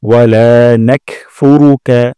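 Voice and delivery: "লা" stretched one alif long; slow recitation, lengthen the লা in "নাকফুরুকা"; drawn-out Arabic recitation. Shown as a demonstration. Teacher showing students the long লা. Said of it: "লাতে এক আলিফ টান".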